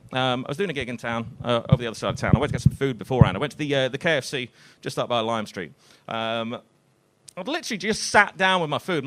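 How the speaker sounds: usual speaking voice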